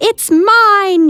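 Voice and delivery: high, loud claim of ownership; shouted. Acted. Squirrel shouting at his rival over a pine cone. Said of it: "shouted Cyril".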